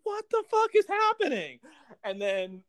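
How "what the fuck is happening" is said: high, squeaky baffled outburst; much higher, confused robot. Retold or imitated. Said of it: "the Chronicons like".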